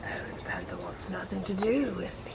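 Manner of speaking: hushed whisper. Real, not acted. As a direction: whisper so the animal won't hear. Whispers while watching the panther.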